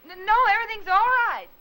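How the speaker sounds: loud, quick, stumbling denial; nervously loud brush-off; flustered. Acted. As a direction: hurry to wave off the help. Flustered reply to neighbor's offer.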